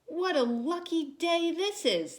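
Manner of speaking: put-on high delighted young donkey; thrilled at his luck; excited. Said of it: "thought Sylvester".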